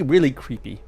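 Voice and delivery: normal talking voice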